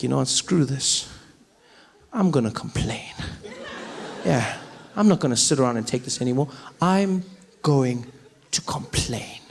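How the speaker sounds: low, firm mock determination; grim resolve. Locked-out man resolving to complain, grimly determined. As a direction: spell out your resolve, sinking low at the end.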